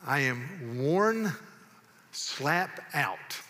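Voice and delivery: very slow, savored words; slow folksy quotation for effect; drawn-out Southern saying. Acted. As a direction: drag it out with a pause before the punch. Reciting Southern sayings as a test.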